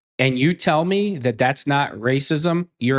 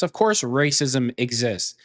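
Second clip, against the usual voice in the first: usual speaking voice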